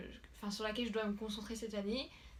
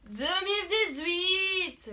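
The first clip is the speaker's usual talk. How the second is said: excited announcement; 9 semitones higher, 10 dB louder, slower than usual. Real, not acted. Announcing the year.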